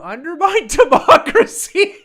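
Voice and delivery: mocking laugh on democracy; cracking up. Real, not acted. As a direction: burst out laughing at the phrase. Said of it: "Oh, that cracks me up".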